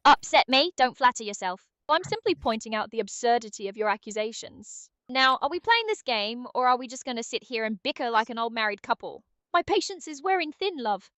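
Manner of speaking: put-on scornful, impatient British accent; British Aria. Sidekick bickering with the player, impatient British disdain. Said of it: "Can British Aria come back for a bit?".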